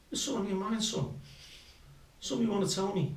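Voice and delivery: high-pitched coaxing mum; imitated concerned mother. Mother gently probes her son.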